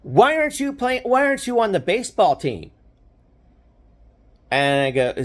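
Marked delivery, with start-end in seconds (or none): 0.0-2.7 high, incredulous question; can't believe this guy isn't on the team; astonished pitcher. Retold or imitated. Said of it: "and he goes".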